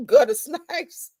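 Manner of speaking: slow words dissolving into a real laugh; breaking into laughter. Real, not acted. Streamer reading an insult from chat, breaking into laughter. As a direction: crack up as you read it.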